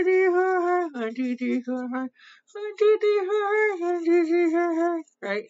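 talking while brushing teeth; speaking around a toothbrush; toothbrush in mouth. Real, not acted. Seen 0: Brush in mouth at start, removed and held below mouth by 0.8s.

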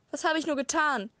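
despairing father cries out after his daughter; howling in despair. A narrator's reading. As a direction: wail it into the night. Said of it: "heulte er noch in die Nacht hinaus".

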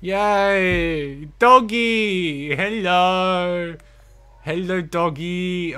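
loud, high, drawn-out cheer; overjoyed greeting. Real, not acted. Player greeting the dog that came back, real overjoyed cry. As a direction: squeal hello to a beloved dog.

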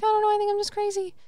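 high, fast, squeaky self-mockery; self-doubting. Mocking her own skepticism to her cohost, high and fast. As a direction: rattle off your doubts in a high silly voice. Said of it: "And I'm like".

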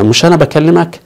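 usual speaking voice

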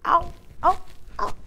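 high howling fox cry; high yowling fox call. Acted. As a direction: howl it like a fox. Fox howl in story.

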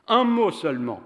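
loud, high insistent cry; man pressing Alice for just one word, loud; exclaiming. A narrator's reading. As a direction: exclaim it urgently. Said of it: "s'exclama-t-il".

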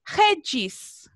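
"redes" said HEH-djis, raspy h at the start; initial R as Spanish J. Shown as a demonstration. Teacher modelling "redes" for pupils, R like J. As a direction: open "redes" with a Spanish jota, end on "djis". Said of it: "se pronuncia como J".

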